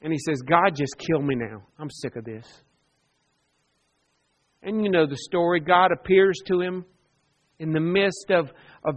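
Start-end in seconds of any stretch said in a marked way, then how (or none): 0.4-2.6 softer, lower, worn-out despair; depressed. Retold or imitated. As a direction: let it sink away in hopeless fatigue. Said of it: "discouraged, defeated, and depressed".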